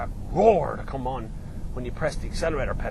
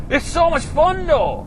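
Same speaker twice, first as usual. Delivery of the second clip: high, loud and thrilled; giddy delight; 13 semitones higher, 8 dB louder, slower than usual. Real, not acted. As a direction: gush straight after a big laugh. Still giddy after laughing.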